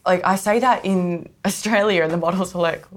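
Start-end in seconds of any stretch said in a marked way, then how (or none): none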